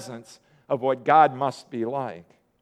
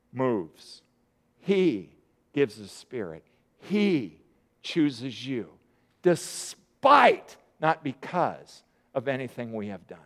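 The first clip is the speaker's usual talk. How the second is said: solemn hush; 5 semitones lower, 5 dB quieter, slower than usual. Real, not acted. Solemn point on grace.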